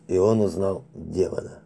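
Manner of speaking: usual speaking voice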